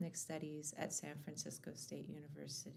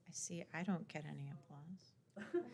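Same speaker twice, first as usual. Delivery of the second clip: ordinary talk, nothing marked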